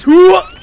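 loud, high whoop; triumphant hoo-ah. Real, not acted. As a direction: let out a big hoo-ah. Cry as the hit lands.

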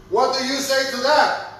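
normal talking voice

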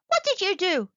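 high loud demand; Squeaky demands an answer from the apple fiend, angrily. A narrator's reading. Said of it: "he demanded".

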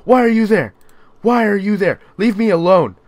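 high, loud panicked pleading; panicked yelling. Real, not acted. Player begging a horror-game creature to leave, panicked yelling. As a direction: yell in real fright, begging it off.

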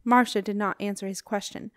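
usual speaking voice

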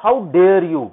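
"How dare you" loud, climbing to a peak on "dare"; rising tone demo. Shown as a demonstration. Teacher demonstrates the tone to students, loud swell on "dare". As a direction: push up hard through "How dare", drop on "you". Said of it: "ये किस तरह बोलेगा?".

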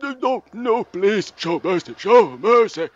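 high, hammed-up plea; mock begging. Beaten fighter begging rival for mercy, hammed-up plea.

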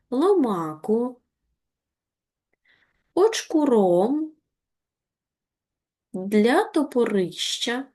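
each word read slowly and separately, with pauses; slow, careful reading. Shown as a demonstration. Teacher reads the word list slowly so pupils mark the stress. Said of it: "звертайте увагу на наголос".

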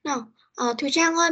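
usual speaking voice

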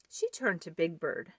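usual speaking voice

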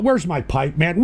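ordinary talk

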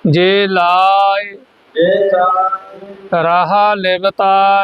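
ਲਾਏ held long with a faint ending, then pause; drawn-out recitation. Shown as a demonstration. Teacher leading learners' repetition, slow stretched recitation. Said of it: "ਲਾ ਲੰਮਾ ਲਿਜਾਣਾ ਹੈ".

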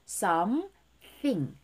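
SOMETHING split as "some... thing" with a pause; "some", pause, "thing" with tongue-between-teeth TH; split-syllable TH demo. Shown as a demonstration. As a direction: isolate the TH by pausing before THING. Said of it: "티에이치 발음".

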